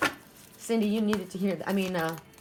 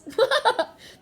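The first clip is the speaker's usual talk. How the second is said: high, loud burst of laughter; 6 dB louder than usual. Real, not acted. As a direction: break into a real laugh. Laughs at her own remark.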